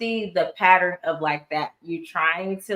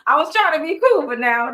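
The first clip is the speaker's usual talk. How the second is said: exasperated outburst; 8 semitones higher, 6 dB louder than usual. Retold or imitated. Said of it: "it's like".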